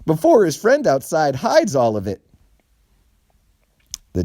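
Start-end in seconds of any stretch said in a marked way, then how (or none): 0.1-2.2 much higher, louder eager sidekick; Big Boy voice. A narrator's reading. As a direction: rush to help, eager sidekick. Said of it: "said Big Boy".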